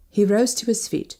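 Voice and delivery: ordinary delivery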